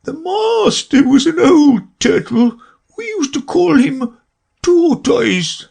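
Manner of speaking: very high, slow weepy creature voice with a sob; sobbing Mock Turtle. A narrator's reading. Mock Turtle recalling school to Alice, sobbing. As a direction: cry the memory out in a quavering high voice. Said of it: "still sobbing a little now and then".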